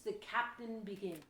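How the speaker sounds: usual speaking voice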